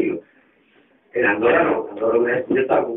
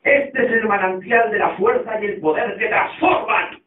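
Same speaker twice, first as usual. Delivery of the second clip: slow, drawn-out declamation with raised pitch; grand, stretched-out sermon line; preacher's proclamation; 5 semitones higher, slower than usual. Acted. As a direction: proclaim it like a zealot holding up the Bible. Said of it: "levantando la Biblia dijo".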